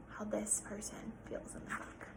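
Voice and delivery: soft unvoiced whisper. Real, not acted. Teacher whispering to the class about the book. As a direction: whisper it like a secret.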